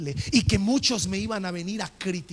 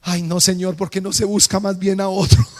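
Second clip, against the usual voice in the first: loud, hammed-up wail of protest; mock crying; 7 dB louder than usual. Terrified Lázaro begs the Lord to pick another, mock crying. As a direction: whine it loudly as if in tears.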